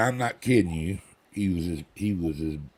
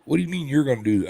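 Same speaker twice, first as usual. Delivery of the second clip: quick, high retold objection; cantankerous; 7 semitones higher, 4 dB louder, faster than usual. Patient objects to the young doctor operating, quick and cantankerous. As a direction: rattle off an affronted challenge. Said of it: "I'm an old cantankerous part".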